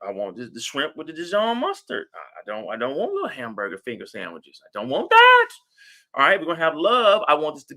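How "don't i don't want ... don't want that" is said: squealing refusal. Acted. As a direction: shriek it like a spoiled bride. Mock refusal of wedding food.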